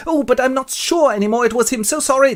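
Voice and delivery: high-pitched apologetic voice; wavering witness. Acted. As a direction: plead doubt in a put-on high voice. Witness wavers before the judge.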